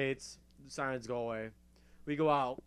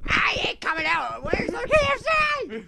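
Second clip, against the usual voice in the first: very high, squeaky panicked voice; hiding friend whining high about the missing KFC; panicky whiny friend. Retold or imitated. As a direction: whine it from behind a slammed door.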